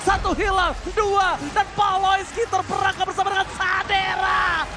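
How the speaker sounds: loud rising hype call; shouted play-by-play. Acted. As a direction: build to a triumphant roar. Climactic team fight call. (teriakan komentator yang memuncak)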